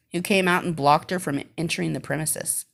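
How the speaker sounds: ordinary delivery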